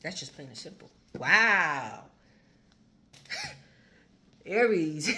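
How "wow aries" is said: loud delighted wow, then laughing; excited laugh. Real, not acted. Reader reacting to the cards, loud and laughing. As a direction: burst out in delighted disbelief.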